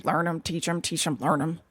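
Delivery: low, soft muddled mutter. A narrator's reading. Rat muddled in his corner, muttering. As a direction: mumble it to yourself. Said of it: "he could be heard muttering".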